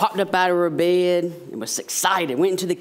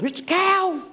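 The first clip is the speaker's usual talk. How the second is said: much higher squeaky mouse voice; pleading mouse; 14 semitones higher than usual. Acted. Mouse begging the cow for help, high squeaky plea. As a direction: squeak it out fast and desperate. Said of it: "And he says".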